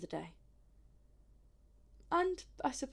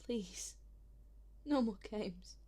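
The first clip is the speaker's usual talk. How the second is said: weary plea; 9 dB quieter than usual. Acted. Pleads wearily for the truth.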